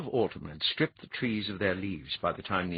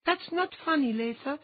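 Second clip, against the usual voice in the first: a much higher, curt retort; snapped. A narrator's reading. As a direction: snap it back, offended. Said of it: "Andine snapped".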